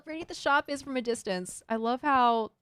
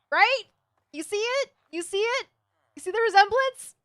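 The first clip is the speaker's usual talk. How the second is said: excited; 10 semitones higher, 7 dB louder than usual. Real, not acted. Showing chat the picture resemblance.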